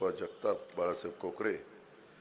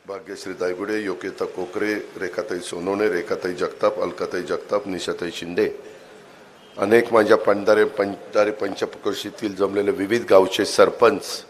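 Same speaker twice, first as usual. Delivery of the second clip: louder and louder roll call, ending in a full shout; rally shout; 12 dB louder than usual. Real, not acted. Speaker at the rally calling out the guests' names, building to a shout. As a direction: belt the names out to the back of the crowd.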